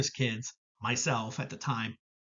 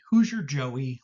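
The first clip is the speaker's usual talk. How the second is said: normal talking voice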